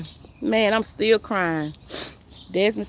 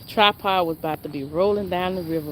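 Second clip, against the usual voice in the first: usual speaking voice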